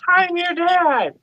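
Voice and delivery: high, slow and drawn out, pleading; exasperated insisting. Retold or imitated. Locked-out dad pleading with his daughter, high and slow. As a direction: stretch it out in disbelief.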